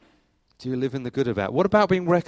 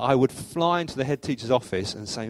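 ordinary delivery

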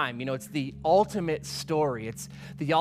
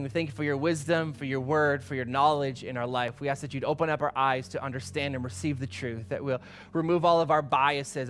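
prayer; 4 semitones lower than usual. Acted. Pastor praying for the congregation before the sermon, hushed.

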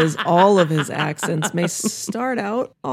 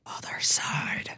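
whispering; 8 dB quieter than usual. Pretend. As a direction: ghostly whisper from beyond. Mocking haunted ghost reference.

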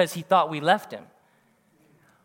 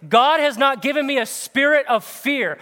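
loud, higher proclamation of scripture; bold declaration; 6 semitones higher, 5 dB louder than usual. Pretend. Preacher teaching the church to speak against fear, boldly. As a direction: declare it like a promise. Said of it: "And you say this".

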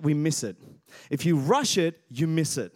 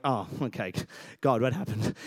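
deflated; 6 semitones lower than usual. Retold or imitated. Pastor acting his dismay at the long line, mock deflated. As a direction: let the air out, half-joking disappointment. Dad sees long queue.

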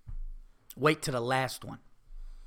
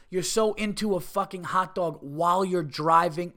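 ordinary talk, nothing marked

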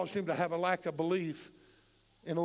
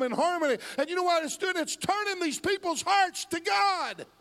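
raised, high-pitched pulpit delivery; impassioned preaching; 8 semitones higher, 5 dB louder than usual. Real, not acted. Preacher telling congregation of a nation in chaos, fervent.

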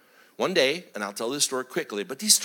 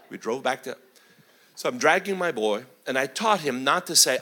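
ordinary delivery

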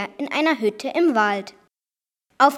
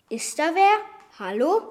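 ordinary delivery

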